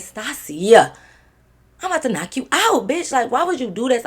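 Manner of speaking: high-pitched exasperated scolding; retelling an annoyed telling-off; retold irritation. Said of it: "I just was irritated".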